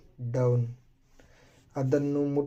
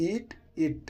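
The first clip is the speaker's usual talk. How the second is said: eat it lifted clearly higher; higher demo of eat it; higher model repeat; 7 semitones higher, 3 dB quieter than usual. Shown as a demonstration. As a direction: say eat it again up high. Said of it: "repeat with a changed voice".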